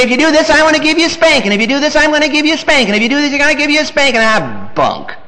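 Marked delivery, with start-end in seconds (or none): 0.0-4.2 fast, higher, singsong repeated threat; mock parent threat. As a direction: rattle off an empty threat to a child. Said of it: "So parents say".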